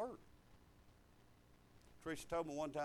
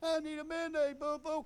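higher, mock-pleading kid voice; whiny imitation; 10 semitones higher, 4 dB louder than usual. Father mocking his son begging for a Band-Aid, whiny. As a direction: whine it like a spoiled boy. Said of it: "He'll go".

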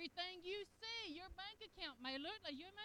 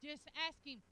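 ordinary talk

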